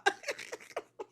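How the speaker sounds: high, breathy real laughter; wheezing laugh. Real, not acted. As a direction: crack up helplessly. Cracking up at the retold meltdown.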